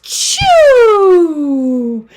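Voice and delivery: huge loud high sneeze "chew"; big sneeze. Acted. Bear sneezes at the party, loud sneeze. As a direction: blast out the sneeze.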